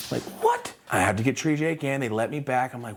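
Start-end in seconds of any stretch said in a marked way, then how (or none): 0.4-0.7 very high, squeaked question; shocked disbelief. Retold or imitated. Told the pass is wrong, high shocked yelp.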